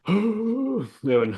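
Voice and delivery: high slow drawn out; excited. Real, not acted. Sudden high drawn start.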